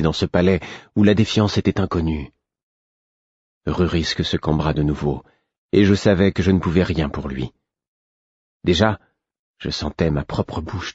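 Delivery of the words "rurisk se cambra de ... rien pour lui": usual speaking voice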